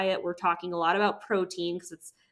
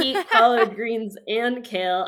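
giggly mock answer; giggling mock reply; laughing through it; 3 semitones higher, 5 dB louder than usual. Real, not acted. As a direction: say it while giggling. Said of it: "[name]'s got the giggles".

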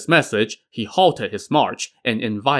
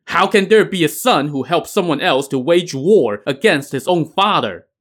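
higher-pitched, restrained shout; mild narrated shout; 6 semitones higher, 3 dB louder than usual. A narrator's reading. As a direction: read it as an indignant shout, only half raised. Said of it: "Shen Gong Bao now shouted".

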